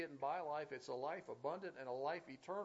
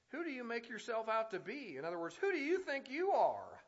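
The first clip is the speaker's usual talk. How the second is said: louder, higher scornful demand; indignant challenge; 7 semitones higher, 8 dB louder than usual. Retold or imitated. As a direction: fling the question at him in disbelief.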